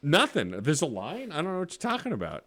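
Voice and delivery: high-pitched mock innocence; playing dumb. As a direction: act clueless, pitched well up. Mock answer to curious passers-by.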